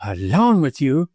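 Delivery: widow's disgusted echo. A narrator's reading. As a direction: repeat it in tones of disgust. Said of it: "repeated the widow in tones of disgust".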